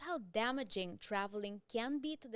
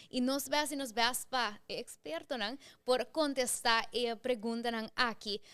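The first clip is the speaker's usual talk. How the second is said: ordinary talk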